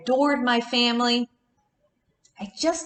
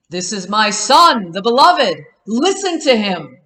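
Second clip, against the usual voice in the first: booming divine voice; 8 dB louder than usual. Acted. Voice from the cloud.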